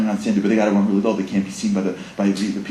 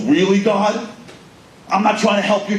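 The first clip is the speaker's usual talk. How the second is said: angry rant; 10 semitones higher, 5 dB louder than usual. Retold or imitated.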